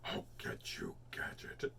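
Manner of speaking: whispered low villain threat. Retold or imitated. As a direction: hiss it like a cartoon villain. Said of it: "Sound like when you go".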